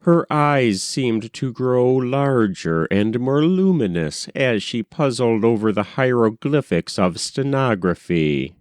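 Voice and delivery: ordinary delivery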